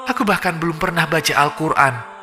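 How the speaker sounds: high, rising, begging cries to God; desperate pleading prayer. Pretend. The dead soul begging God from the grave, desperate high pleading. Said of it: "Kau memohon".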